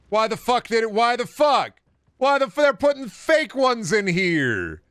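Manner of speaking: high, loud, incredulous rant; exasperated outburst. Real, not acted. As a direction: yell it in rising disbelief. Exasperated outburst at fake doors in game.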